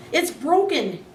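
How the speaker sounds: high startled exclaim. A narrator's reading. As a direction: blurt it out in alarm. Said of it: "he exclaimed".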